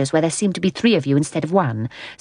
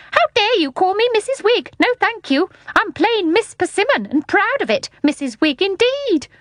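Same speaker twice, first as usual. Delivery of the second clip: very high, thin, outraged lady; 13 semitones higher than usual. A narrator's reading. Thin lady to visitors who misnamed her, outraged thin voice. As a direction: pipe it out in thin indignation. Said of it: "in a voice even thinner than herself".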